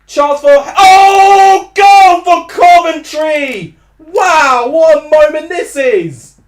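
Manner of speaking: loud, slow, ecstatic goal call; excited goal shout. Real, not acted. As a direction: roar it out as the goal goes in. Goal scored, commentator erupts.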